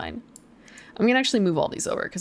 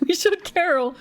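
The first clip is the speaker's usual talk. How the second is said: gleeful high cheer; laughing, triumphant; excited; 8 semitones higher than usual. Real, not acted. Cheering the win.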